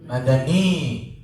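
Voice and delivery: Madani drawn out slowly, each syllable clear; slow Arabic word. Shown as a demonstration. Teacher modelling the word for the class, slow pronunciation. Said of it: "If you're from Medina, we call you".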